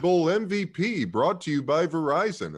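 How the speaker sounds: high, loud hammed-up ad announcer; mock sponsor announcer. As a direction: sell the sponsor like a stadium announcer.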